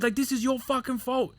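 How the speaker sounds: high-pitched retold accusation; angry blame. Trainee blaming officer after rough landing, angry.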